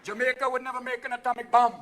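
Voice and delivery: Jamaican lilt on every word; easy Jamaican patois; Jamaican accent. Retold or imitated. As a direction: keep the Jamaican Rasta voice going. Continues Rasta voice.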